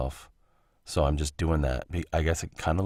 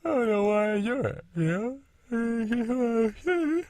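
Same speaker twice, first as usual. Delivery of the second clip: talking through a big yawn, pitch jumping high; slower than usual. Real, not acted. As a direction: stretch the words out through a yawn. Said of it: "Sorry if I made you yawn".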